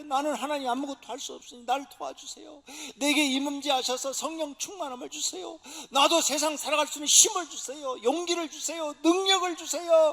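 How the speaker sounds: loud, rising cry to God; desperate prayer building to a cry. Acted. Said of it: "막 울며 부르짖듯 기도할 때".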